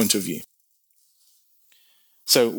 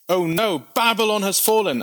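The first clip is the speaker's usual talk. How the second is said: higher, alarmed oh-no cry; dismayed cry; 8 semitones higher than usual. Acted. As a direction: announce the disaster in dismay. Watchman announces Babylon's fall.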